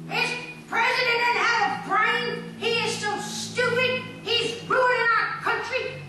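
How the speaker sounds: high, loud, slow mock rant; a retold tirade, pitched high and loud; ranting woman. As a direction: spew it out like an angry stranger. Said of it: "just started spewing".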